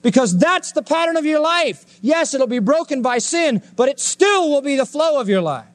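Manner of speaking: high, ringing preacher's insistence; preaching fervor. Real, not acted. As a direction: lift it up and drive it home. Preacher rising to emphatic peak.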